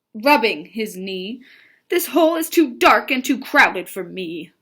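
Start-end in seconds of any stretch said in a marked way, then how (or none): 1.9-4.5 loud, emphatic grumble; mildly exasperated read-aloud character; storybook frog complaint. Acted. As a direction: complain loudly as a frog leaving.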